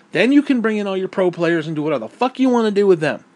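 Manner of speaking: ordinary delivery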